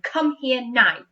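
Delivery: loud bossy order; bossy command. Shown as a demonstration. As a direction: boss the class around. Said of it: "I'm being bossy and saying".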